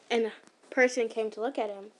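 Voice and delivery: ordinary delivery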